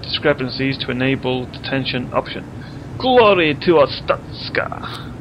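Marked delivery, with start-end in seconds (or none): 3.0-5.1 slow, high hammed-up Russian-Welsh accent; mock Russian accent. Gamer saluting the game's nation in a mock Russian accent. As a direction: proclaim it in a bad Russian accent. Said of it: "Russian cum Welsh accent".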